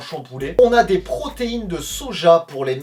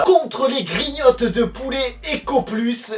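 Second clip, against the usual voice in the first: slow, drawn-out, high mock-announcer voice with a laugh; playful announcement; 6 semitones higher, slower than usual. Reviewer introducing the cheap rival to his viewers, slow and playfully hammed-up. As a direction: present the rival product like a laughing game-show host.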